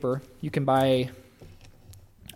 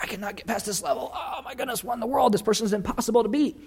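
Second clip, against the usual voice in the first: pitched-up, exasperated whining at a video game; frustrated gamer; 8 semitones higher than usual. Retold or imitated. Preacher retelling being stuck at a video game, exasperated. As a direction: complain about an unbeatable level. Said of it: "I'll be like".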